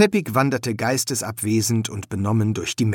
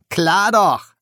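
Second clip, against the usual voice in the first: high, loud, cocky reply; smiling, self-assured exclamation; breezy confidence; 8 semitones higher, 7 dB louder than usual. A narrator's reading. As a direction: toss it off brightly, like it was easy. Grinning confident reply to friend.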